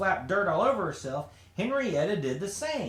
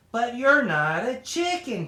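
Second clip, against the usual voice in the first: louder, slow and insistent, pitched higher; screaming; 5 semitones higher, 5 dB louder than usual. A narrator's reading. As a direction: hammer each word at her stubborn sister. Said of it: "Kim screamed".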